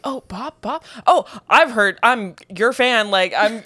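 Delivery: playful flustered admirer; mock starstruck. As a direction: gush in fits and starts. Coach as flustered fan.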